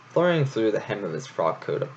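usual speaking voice

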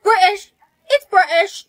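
loud, put-on British accent. Student names her soulmate's accent, put-on British accent. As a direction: announce it loudly in a British accent. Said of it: "speaking in a British accent".

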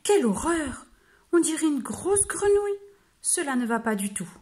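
the wolf recoils at his own reflection; wolf's disgusted exclamation. Acted. Wolf appalled at the mirror, crying out.

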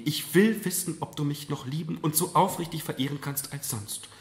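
high, fervent plea; earnest insistence. A narrator's reading. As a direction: press for assurance of love. Kneeling mother insists on an answer.

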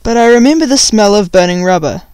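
ordinary delivery

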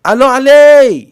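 high, full-voiced holler; shouted answer. A narrator's reading. Uncle answering from the hut, shouting. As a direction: yell back over a long distance. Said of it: "काकानं ओरडून म्हटलं".

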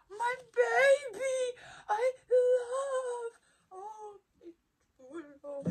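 high, slow, choked-up squeal; tearful squeal. Real, not acted. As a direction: overcome with love for a tiny toy. Overwhelmed by the figure.

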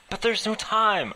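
loud, high, frantic protest; desperate loud interruption; cutting in. Acted. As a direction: cut her off in alarm. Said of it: "I interrupted".

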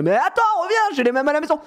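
high pleading call; calling after cat. Retold or imitated. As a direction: coax the cat back, voice up high.

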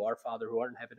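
low, soft, mildly robotic recitation; robotic praying. Retold or imitated. Pastor showing how people pray by rote, mildly robotic. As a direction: lightly mimic a prayer said by rote. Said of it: "we pray it like a robot".

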